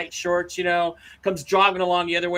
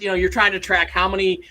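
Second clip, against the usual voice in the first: ordinary talk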